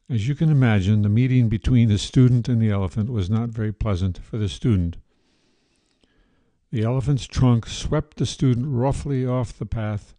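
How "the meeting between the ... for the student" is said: ordinary delivery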